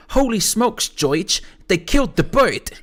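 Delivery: put-on high, loud New York kid. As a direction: exclaim like a cartoon Brooklyn child. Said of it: "most stereotypical accent I've ever heard".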